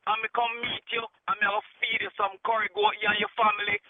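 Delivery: normal talking voice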